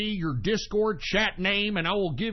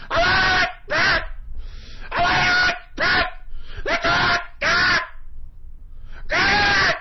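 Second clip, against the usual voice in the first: sobbing, much higher and louder pleas; on-air meltdown, crying and yelling; crying shout; 3 dB louder than usual. Real, not acted. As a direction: wail it out through tears. Host breaks down demanding respect.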